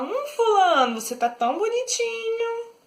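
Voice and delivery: high, sneering fake praise; mocking. Shown as a demonstration. Teacher showing a mocking compliment to someone on the street. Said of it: "com ar de deboche".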